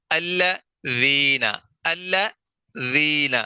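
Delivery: "الذین" drawn out very slowly, tongue tip just out for the ذ; slow careful recitation. Shown as a demonstration. Tajweed teacher models the correct recitation. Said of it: "زبان کی نوک ہلکی سی باہر نکالنی ہے".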